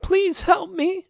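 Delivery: very high, slow whimper. A narrator's reading. Trapped sheep begging in the dark, whimpering. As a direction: beg weakly, close to tears. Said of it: "she whimpered".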